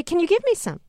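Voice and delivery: high, lightly voiced coaxing request; experimenter asking baby. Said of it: "and say".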